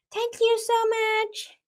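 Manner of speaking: put-on high, slow childlike thanks; sweet high child's thank-you; little girl voice.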